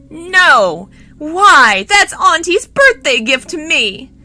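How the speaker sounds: high, loud wailing protest; acted outraged shouting; shocked yell. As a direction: yell like a spoiled prince losing a treasure. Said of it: "was shocked and yelled".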